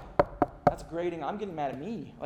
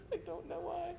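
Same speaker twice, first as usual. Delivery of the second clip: bewildered apology; 9 semitones higher, 3 dB quieter than usual. Retold or imitated. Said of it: "Have you ever been angry and be like".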